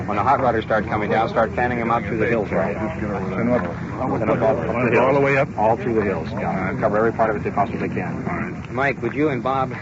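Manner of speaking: usual speaking voice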